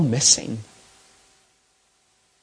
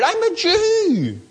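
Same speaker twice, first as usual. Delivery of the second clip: entitled complaint; 6 semitones higher than usual. Retold or imitated. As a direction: whine it like someone owed better. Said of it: "He plays the race card".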